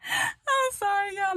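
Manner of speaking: slow, very high crying laugh; laughing to tears. Real, not acted. To viewers, crying with laughter at mama. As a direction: squeeze it out through tears of laughter. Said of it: "I'm about to cry".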